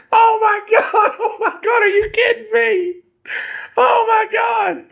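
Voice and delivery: very high, wailing mock cry of agony; mock panicked wail. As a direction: imitate someone crying out in disbelief and pain. Acts hooked boy in agony.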